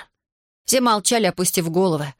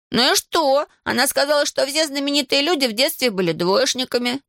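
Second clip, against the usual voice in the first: put-on high-pitched boyish comeback; Ivan, defiant schoolboy; 8 semitones higher than usual. A narrator's reading. Schoolboy defends his boast to classmate, defiant. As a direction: argue back with the pitch held up.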